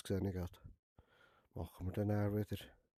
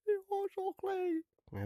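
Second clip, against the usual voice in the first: squeaky, much higher puppet voice for the shy customer; nervous customer's reply. Acted. A nervous customer answering the barber in a high squeaky voice. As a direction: pipe it up high and timid, like a nervous little client.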